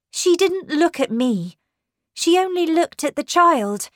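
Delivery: high, loud, urgent emphasis; agitated explanation. A narrator's reading. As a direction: explain it with alarm. Governess agitatedly explains the look.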